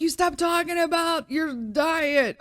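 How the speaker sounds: high, drawn-out mocking voice; exaggerated high-pitched nagging; mock complainers. Voicing complaining followers.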